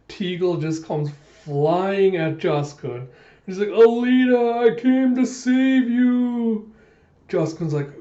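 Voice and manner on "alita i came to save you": high, loud, drawn-out rescue cry; heroic shout. Retold or imitated. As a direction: call out like a hero charging in.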